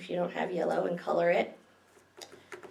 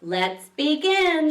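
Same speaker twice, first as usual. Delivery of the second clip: slow, higher and louder call to order; librarian voice; 8 semitones higher, 6 dB louder, much slower than usual. Acted. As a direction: call the room to order slowly. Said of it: "she says".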